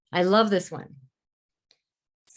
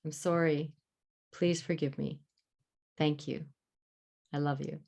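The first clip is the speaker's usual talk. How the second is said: soft and tender, fading quieter on each phrase; tender soft mantra; 4 semitones lower, 7 dB quieter than usual. Shown as a demonstration. Teacher softly soothing her own triggered part with the mantra. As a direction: say it like a hand on your heart.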